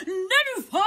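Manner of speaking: high, loud drawn yell. A narrator's reading. Toad yells his wish as he jumps, loud yell. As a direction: bellow the wish out.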